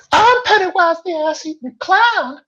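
very high, loud, shrieking evil clown; Pennywise. Acted. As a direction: screech it like a monstrous clown. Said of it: "in a high and terrifying voice".

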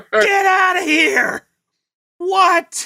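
hammed-up angry mother; mock outraged mom. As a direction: chase him off, laughing. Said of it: "She's like".

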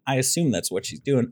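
ordinary talk, nothing marked

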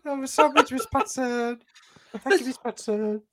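put-on high whiny teenage boy voice; Kevin and Perry teen voice. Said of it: "you sound like Perry".

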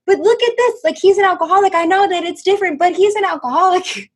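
put-on high, loud childlike arguing; imitated emphatic kid protest; insistent kid. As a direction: argue back like an excited ten-year-old. Acts out insistent child self.

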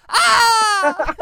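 high agonized scream; burning scream. Pretend. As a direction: scream as if set on fire. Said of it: "to scream in agony".

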